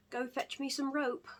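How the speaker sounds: lightly put-on British accent for the boss's order; terrible British accent. Imitating the boss's British accent, badly. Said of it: "That was a terrible British accent".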